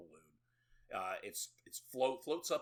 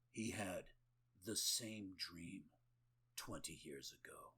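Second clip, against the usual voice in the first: mock-ominous; 3 semitones lower, 8 dB quieter than usual. Character turning on his way out, mock-scary reveal. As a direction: drop low and soft, pausing for dread. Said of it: "this is supposed to scare you".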